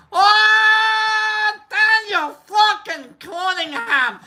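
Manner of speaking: long, high drawn-out holler; hammed-up hail. Streamer spotting a viewer in chat, drawn-out high holler. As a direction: bellow the name in slow, exaggerated delight.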